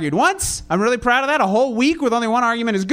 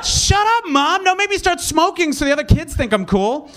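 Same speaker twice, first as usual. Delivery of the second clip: put-on bratty teen; 7 semitones higher than usual.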